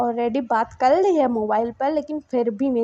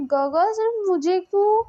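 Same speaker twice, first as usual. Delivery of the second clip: indignant protest; 9 semitones higher than usual. Retold or imitated. Younger one protests to uncle.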